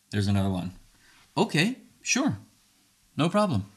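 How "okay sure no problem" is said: accommodating. Acted. Speaker answers a complaint about how they talk, accommodating. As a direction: agree easily, pitched well up. Models agreeable reply to accusation.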